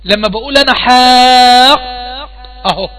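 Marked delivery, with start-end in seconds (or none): 0.7-1.8 "حاق" with a long held, louder vowel, closed on a vowelless qaf; stop on the qaf. Shown as a demonstration. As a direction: stretch "حاق" loudly, then halt on a still ق. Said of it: "وقفت على القاف أنا سكون". ("حاق" بمدّ طويل عالٍ ثم وقف على القاف الساكنة)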